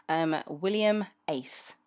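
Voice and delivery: normal talking voice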